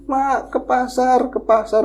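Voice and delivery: put-on high, slow child's call; child waking her. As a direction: wake grandmother like a child. Anak membangunkan. (anak membangunkan ibunya, suara tinggi pelan)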